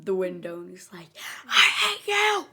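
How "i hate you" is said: high, strained squeal of fury; imitated tantrum, shrieked high and loud; mock angry tantrum. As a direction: act out a hammed-up screech. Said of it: "he's going through it again".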